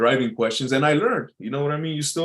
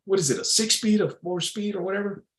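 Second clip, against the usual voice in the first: put-on old timer; 9 semitones higher than usual. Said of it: "I had this old timer".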